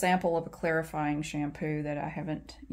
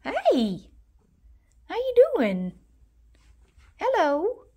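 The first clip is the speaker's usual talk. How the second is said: much higher, louder cheery greeting; high pet greeting; 11 semitones higher, 7 dB louder, slower than usual. Real, not acted. Greeting the cat as she comes in, high loud.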